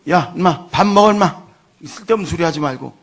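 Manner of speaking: put-on gang-boss father shouting at his crying boy to eat; gruff scolding. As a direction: bark at the kid to eat and quit it.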